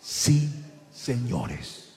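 slow, separate words sinking low; read-my-lips emphasis. Real, not acted. Preacher asking the church to read his lips, slow and deliberate. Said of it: "léame los labios".

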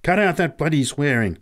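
irritated, forceful telling-off; angry snap. A narrator's reading. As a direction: snap angrily at a child. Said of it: "snapped Pat angrily".

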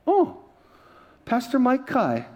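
high, slow recognising gasp; starstruck surprise. Retold or imitated. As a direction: gush at a famous name. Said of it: "She looks".

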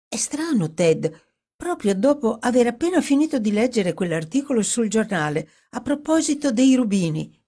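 intrigued, lifted character voice; Dorothy, puzzled. Acted. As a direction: wonder aloud at the coincidence.

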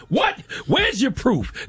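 squeaky, high mock-indignant voice; mocking David. Host mocking David's reply, high and indignant. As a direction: mimic him yelping back in disbelief.

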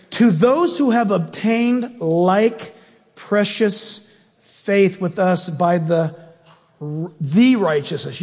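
slow reading, leaning hard on "the righteousness"; stress on THE. Shown as a demonstration. Preacher to congregation, slow reading that stresses "the". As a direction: drag the verse out and punch "the" before "righteousness". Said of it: "You should circle the word "the".

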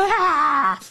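high-pitched imitated scream at the sky; cartoonish "aah" of despair; mock scream. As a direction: wail theatrically like a hysterical protester. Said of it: "screaming at the sky".

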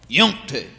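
"yumpty", want to run into one word; Southern slurred word. Shown as a demonstration. Comedian showing a Southern word, slurred together. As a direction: say "yumpty" low and soft, syllables fused. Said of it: "we have words like".

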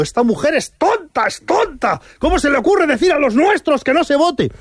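high-pitched exasperated mock outburst; mock shouting. Host imagining party leader fuming in his office, mock shouting. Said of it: "tenía que estar gritando".

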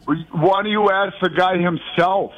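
ordinary talk

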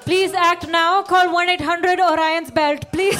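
loud, pleading charity commercial. Pretend. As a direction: plead like a rescue-animal ad. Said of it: "like an A S P C A commercial".

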